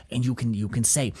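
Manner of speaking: low, soft mock warning in a put-on accent; instructor impression. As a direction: lightly imitate a cautioning instructor. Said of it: "He's like".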